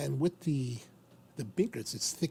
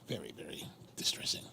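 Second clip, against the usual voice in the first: whispered dismay; 11 semitones lower, 8 dB quieter than usual. Real, not acted. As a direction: whisper it, much lower and softer. Cigar went out.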